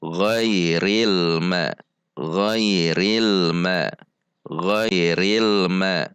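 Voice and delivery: "ghairil ma" slow, connected recitation; practice reading. Shown as a demonstration. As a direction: recite "ghairil ma" slowly, l flowing into ma. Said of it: "melatihnya dengan membaca". ("ghairil ma" dibaca pelan dan bersambung)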